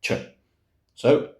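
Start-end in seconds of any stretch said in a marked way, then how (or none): 0.0-0.1 short crisp ch; ch sound demo. Shown as a demonstration. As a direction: tap out ch alone. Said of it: "gives us ch".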